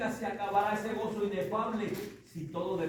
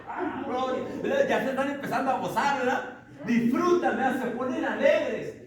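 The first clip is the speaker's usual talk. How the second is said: excited; 6 semitones higher, 7 dB louder than usual. Real, not acted. Preacher enjoys congregation's joy.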